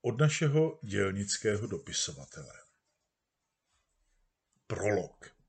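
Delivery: usual speaking voice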